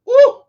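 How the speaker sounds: high loud preacher's woo; excited whoop. Real, not acted. Fired up preaching to his live audience, loud whoop. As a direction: let out a joyful whoop. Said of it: "Y'all get me preaching up in here".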